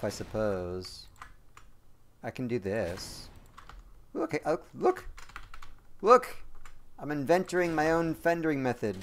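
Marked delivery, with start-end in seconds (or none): 4.1-6.4 very high, rising squeals of look; excited calling out. Real, not acted. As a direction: squeal it out in delight. Excited at own invention.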